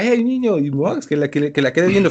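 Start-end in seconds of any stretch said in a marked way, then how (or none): 0.0-0.6 higher-pitched mock call-out; imitated shout. Imagining granny shouting at the boy, higher mock shout. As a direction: call to the boy without really yelling. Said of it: "O sea, que le gritara".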